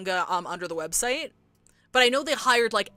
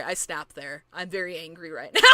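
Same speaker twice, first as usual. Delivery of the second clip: ordinary delivery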